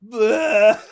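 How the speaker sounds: loud, high drawn fake vomit noise; hammed-up imitation of throwing up; mock retching. Coworker leaning over pretending to puke, mock retching. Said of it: "she's leaning over going".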